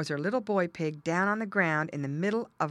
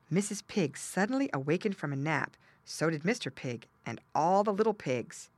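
ordinary delivery

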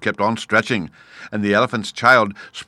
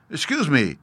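polite; 5 semitones higher than usual. A narrator's reading. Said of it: "said the elephant's child most politely".

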